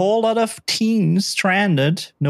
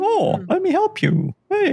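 high, cheerful voice of a helpful shark; sweet and high, playing the innocent shark; shark narrator voice. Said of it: "a little narrator that has the shark's, uh, thoughts".

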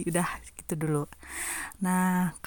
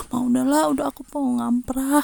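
higher-pitched mock whine to mum; impatient pleading; 5 semitones higher, 3 dB louder than usual. Student urging visiting mother to go home, impatient plea. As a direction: hurry her along, imitating an impatient kid.

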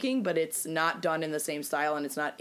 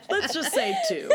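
laughing. Real, not acted. Repeats it after friend's joke.

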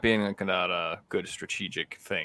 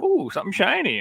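distracted delight; 12 semitones higher, 4 dB louder than usual. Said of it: "I'm like".

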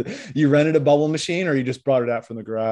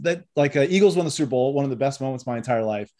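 ordinary talk

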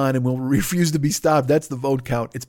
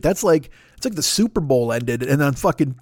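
ordinary delivery

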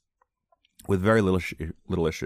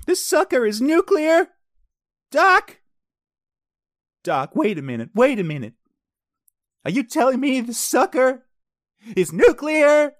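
high, loud, incredulous film hero; frantic, high movie-quote impression; Marty McFly; 7 dB louder than usual. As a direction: cry out in hammed-up disbelief. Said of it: "I love Marty McFly".

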